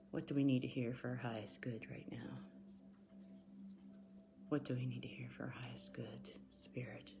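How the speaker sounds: soft whispered prayer to spirit; whispered invocation. Real, not acted. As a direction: hush and ask spirit quietly. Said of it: "asks spirit in whisper".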